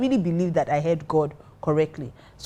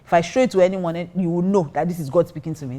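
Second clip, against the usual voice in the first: usual speaking voice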